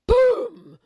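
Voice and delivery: sudden high shouted boom; loud boom. A narrator's reading. Narrator marking the rabbit bumping into the fox, loud. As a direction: shout the crash.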